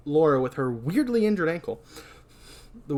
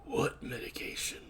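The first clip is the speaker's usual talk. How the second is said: low-volume, whispered question; hushed suspicion; 12 dB quieter than usual. Acted. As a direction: ask it under your breath.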